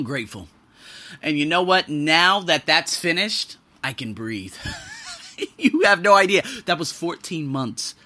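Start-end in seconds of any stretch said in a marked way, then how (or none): 4.6-5.1 short breathy laugh of relief; quick relieved chuckle. Real, not acted. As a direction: let the tension out in a laugh. Relief after long ordeal.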